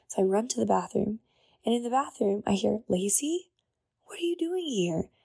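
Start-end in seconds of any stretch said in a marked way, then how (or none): 2.9-5.1 high, bright surprised call of her name; surprised greeting. Retold or imitated. Friend surprised to find Lacey in church bathroom, high excited call. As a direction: act like you just spotted an old friend.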